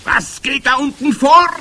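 high, loud imperious witch cry; angry demand. A narrator's reading. Head witch calls down from the podium, angry. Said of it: "grollte die Hoch- und Großmeisterhexe".

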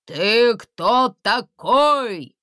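loud, slow booming guard. A narrator's reading. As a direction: thunder the question slowly. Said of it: "прогремело рядом с гуслей".

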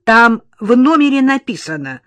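loud, grumpy brush-off; grumpy retort. A narrator's reading. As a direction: snap back like an irked receptionist. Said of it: "буркнула регистраторша".

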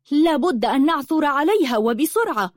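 high-pitched, insistent anger; angry. A narrator's reading. Lulua insists the cat must be found quickly, angry. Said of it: "قالت لؤلؤة بغضب".

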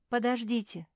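high, loud exclamation; exclaiming. A narrator's reading. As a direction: cry out to stop them. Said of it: "воскликнула Гортензия".

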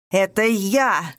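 inhuman roar, slow and loud; eldritch horror. A narrator's reading. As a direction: roar it like something not human. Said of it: "заорал вдруг Рыжов нечеловеческим голосом".

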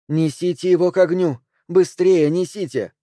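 pressing, lifted voice of command; urgent command. A narrator's reading. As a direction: call out urgently to hurry the bearers. Figure urgently orders others.

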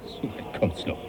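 captain waving away Darwin's threat in a whisper; scoffing under breath. Acted. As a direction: brush it off under your breath.

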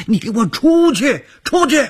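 high, loud screaming through tears; furious scream. Acted. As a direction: scream them out of the room. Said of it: "她大声地吼着".

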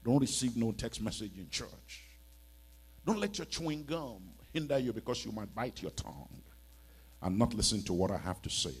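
ordinary delivery

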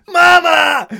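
отчаянный вопль, высоко и громко; вопит, скосив глаза на султана. A narrator's reading. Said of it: "возопил Хейксвилл". (отчаянный вопль «Мама!»)

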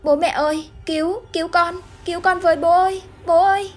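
acted panicked yelling, pitch rising with each call; screaming for help. A narrator's reading. Trapped child calls to parents, screaming in panic. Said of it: "miệng cũng liên tục la hét không ngừng".

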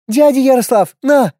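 put-on high excited little girl; child voice. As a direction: call out like an eager kid. Child Nastya calls out.

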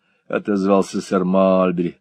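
plain reading voice

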